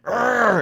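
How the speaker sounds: high, loud mock roar; rolling creature bellow, playfully imitated; imitated hairy creature roar. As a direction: roar like a huge furry beast. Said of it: "seven-foot hairy thing, going".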